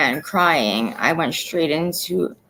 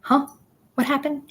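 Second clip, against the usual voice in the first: lightly voiced puzzled dad, pitched up; clueless dad; 10 semitones higher than usual. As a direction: mimic dad's confused question, higher. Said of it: "he was just like".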